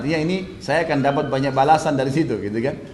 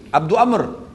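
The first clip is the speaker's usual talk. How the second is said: raised, falling call of a name; 4 semitones higher, slower than usual. Retold or imitated. Umayyah calls to Abdurrahman as he passes, calling out. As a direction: call out to someone passing by. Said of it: "dia panggil".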